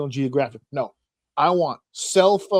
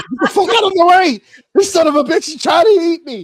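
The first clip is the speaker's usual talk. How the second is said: high, loud, laughing panic; frantic high yell; 12 semitones higher, 10 dB louder than usual. Retold or imitated. Voicing the fleeing turtle to a friend, frantic laughing yell. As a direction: scream it like you're about to be eaten.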